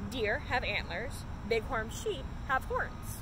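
ordinary delivery

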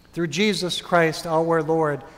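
ordinary delivery

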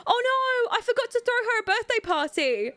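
high, gasping dismay; shocked gasp. Real, not acted. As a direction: realize the mistake in a squeaky panic. Forgot Sim's birthday party.

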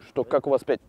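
ordinary talk

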